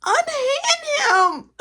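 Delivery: high, slow, theatrical "Unhand him"; drawn-out mock dramatic plea; mock heroic command. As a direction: ham up a stage-hero line. Pleading with grandpa, mock grand.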